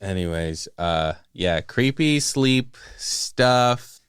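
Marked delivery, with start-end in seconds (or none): 1.6-4.0 very slow, drawn-out words; slow and spooky. Real, not acted. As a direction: drag it out eerily.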